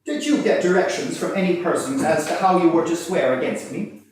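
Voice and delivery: cross-examine with cutting, forcible flair; quaint forcible prisoner. Acted. Frank cross-examining witnesses before the judge, loud forcible pleading. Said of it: "mode of delivery were both quaint and forcible".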